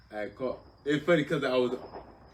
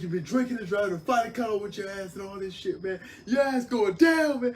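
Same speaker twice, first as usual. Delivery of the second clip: barking sergeant; 11 semitones higher, 8 dB louder than usual. Retold or imitated. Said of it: "He's like".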